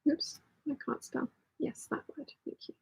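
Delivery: very soft, half-whispered aside; barely audible self-correction; muttering to oneself. Real, not acted. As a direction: talk under your breath while typing. Talking to self while typing.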